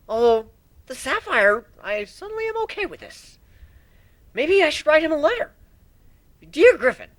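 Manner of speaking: put-on high young dragon perking up at a gem; young dragon, suddenly eager over a sapphire; Spike, greedy. Spike's voice continues.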